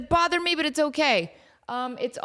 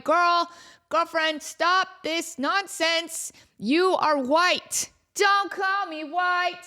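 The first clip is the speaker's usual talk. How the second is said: put-on slow, high sassy girl talk; sassy scolding; 6 semitones higher, much slower than usual. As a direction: scold the cat like a girlfriend. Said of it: "And I'm like".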